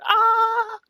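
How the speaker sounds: very high, loud squeal; excited squeal. Retold or imitated. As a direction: squeal with giddy delight. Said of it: "I'm just sitting here".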